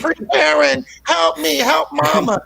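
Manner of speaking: high pleading mom voice; mock mom pleading; pleading mother. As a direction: beg the little girl, high and whiny. Mother begging daughter.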